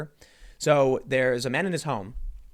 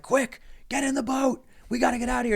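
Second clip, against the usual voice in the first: urgent boatman; 11 semitones higher than usual. Retold or imitated. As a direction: urge him into the boat, pitched up. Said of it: "the guy in the boat's like".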